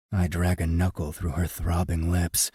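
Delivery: usual speaking voice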